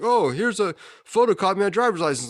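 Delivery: lightly mock his excuse up high; evasive driver.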